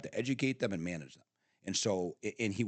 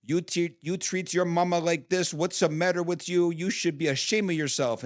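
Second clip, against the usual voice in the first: imitating grandma chewing out a cop, louder; scolding grandma; 6 semitones higher, 6 dB louder than usual.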